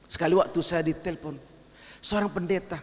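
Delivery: normal talking voice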